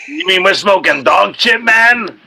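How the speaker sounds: stoner movie quote. Retold or imitated. Quoting Cheech and Chong scene.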